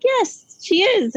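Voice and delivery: high, insistent retort; insistent high yes. Retold or imitated. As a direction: shoot it back, high and certain. Insisting back at mom in quote.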